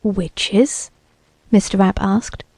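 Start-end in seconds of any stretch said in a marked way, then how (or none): 0.0-0.9 a hint of worry in a soft question; quietly uneasy, asking warily; apprehensive. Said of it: "looked at him apprehensively".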